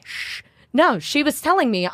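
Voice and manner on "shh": shushing. Retold or imitated. Shushing the woman in retelling.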